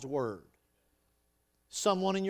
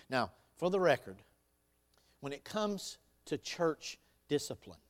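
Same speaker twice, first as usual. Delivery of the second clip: ordinary delivery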